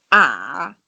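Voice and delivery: A dipping low, then rising; third tone. Shown as a demonstration. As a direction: let A sink down and come back up. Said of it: "the third one is going to be".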